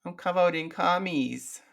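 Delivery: lightly cutesy uwu voice. Acted. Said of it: "uwu bitch voice".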